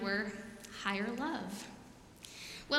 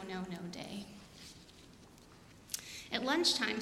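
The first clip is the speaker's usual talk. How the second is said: usual speaking voice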